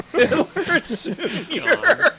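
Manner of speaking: slow, laughing repeat. Real, not acted. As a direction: savour it while cracking up. Repeating the name amused.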